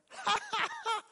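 sudden high-pitched cackling laugh; imitating her cackle. Preacher imitates the woman's joyful cackle, high laugh. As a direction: burst into her shrill cackle. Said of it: "she had this cackle of a laugh".

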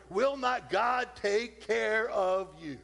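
slowed, each word weighed; slow emphatic question. Acted. Preacher pressing the congregation, slow and weighty. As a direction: lean on every word.

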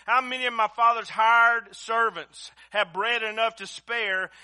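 loud, high, impassioned preacher's cry; fiery preaching. Acted. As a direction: preach it at full force, pleading. Preaching builds to full force.

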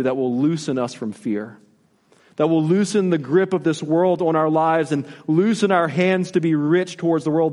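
usual speaking voice

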